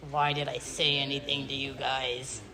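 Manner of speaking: low, soft, deflated grumble; retold regret. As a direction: imitate him giving up, low and quiet. Teased man regrets having told them.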